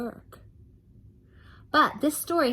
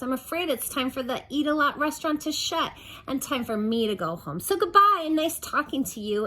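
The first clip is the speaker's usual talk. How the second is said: higher, bubbly nonstop talker; chatty waitress voice; 7 semitones higher, 4 dB louder than usual. Acted. Waitress saying goodbye to Mister Greedy, bubbly and high. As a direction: gush it all out sweetly.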